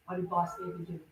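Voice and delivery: plain reading voice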